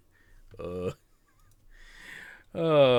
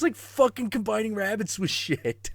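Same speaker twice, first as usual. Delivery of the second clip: usual speaking voice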